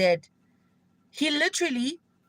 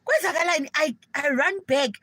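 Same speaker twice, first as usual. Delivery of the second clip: higher, louder panicked calling out; alarmed shouting; 6 semitones higher, 4 dB louder than usual. Retold or imitated. Retelling calling out to friends mid-fight, panicked and loud. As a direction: yell across the room at the fighters. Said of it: "Now I'm like".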